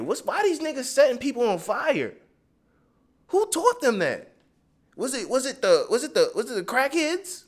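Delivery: venting in disbelief, voice climbing high; real frustration. Real, not acted. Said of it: "I'm getting frustrated".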